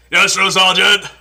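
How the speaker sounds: army shout. Retold or imitated. Recruit answering the drill sergeant, loud shout.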